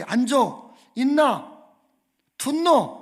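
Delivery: loud barked commands in Jeolla dialect; Jeolla dialect orders. Retold or imitated. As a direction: imitate an army senior shouting orders in Jeolla dialect. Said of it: "갑자기".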